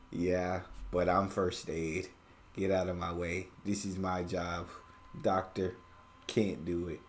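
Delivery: low, deadpan imitation; tough first aider. Viewer mimicking first-aid guy, low tough voice.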